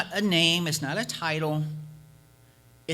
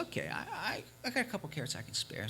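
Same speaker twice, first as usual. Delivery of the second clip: hesitant woman; 3 semitones higher, 10 dB quieter, faster than usual. Acted. Woman offers carrots hesitantly.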